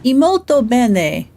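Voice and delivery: loud hammed-up Italian; loud mock Italian. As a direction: belt out mock Italian, slowly. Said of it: "Do I at least have the accent?".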